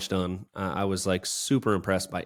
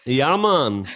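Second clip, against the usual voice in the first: put-on Jamaican accent; 6 semitones higher, 6 dB louder than usual. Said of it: "I kept talking in a Jamaican accent".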